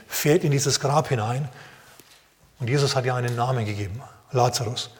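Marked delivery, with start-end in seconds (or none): none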